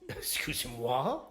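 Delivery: slow, appalled French "Excuse-moi"; shocked French tourist. Retold or imitated. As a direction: play the Frenchman floored by the bill.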